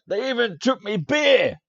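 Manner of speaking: furious dwarf shout, high and loud; shouting and cursing. Acted. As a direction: bellow it out in outrage. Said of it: "shout and curse".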